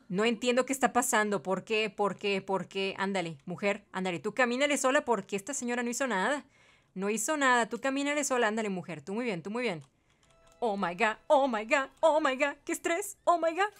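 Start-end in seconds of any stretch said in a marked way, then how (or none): none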